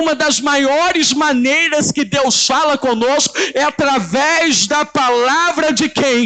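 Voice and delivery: high, driving pulpit voice; full-force preacher in the pulpit; fervent preaching. Acted. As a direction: preach it out to the congregation.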